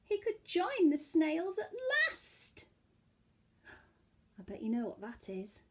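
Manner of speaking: ordinary delivery, nothing marked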